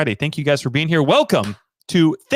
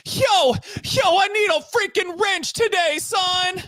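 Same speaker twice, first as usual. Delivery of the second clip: much higher shouted rocker yell; slower than usual. Retold or imitated. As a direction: bark it like a nu-metal frontman. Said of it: "that's how [name] talks".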